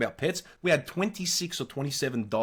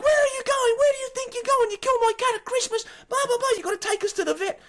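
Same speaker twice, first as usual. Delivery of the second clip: very high falsetto tirade, speeding up; hammed-up shrieking confrontation; mock screaming woman; faster than usual. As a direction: imitate an outraged cat owner yelling. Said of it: "this woman is yelling and screaming at us".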